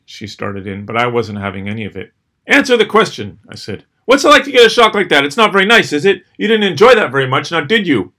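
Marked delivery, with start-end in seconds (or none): 2.5-3.4 angry demand. Retold or imitated. Cutting off the driver. 4.1-8.1 loud, high and fast, mocking the driver; taunting angry yell. Taunting the driver after honking.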